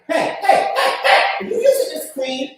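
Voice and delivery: put-on high, loud accusing girlfriend; confronting girlfriend. Girlfriend catches him with her cream, accusing.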